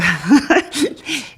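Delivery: short, high real laugh; amused laugh. Real, not acted. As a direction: let out a brief high laugh. Amused about receiving her César.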